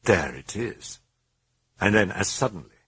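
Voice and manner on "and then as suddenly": plain reading voice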